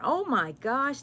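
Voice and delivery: ordinary talk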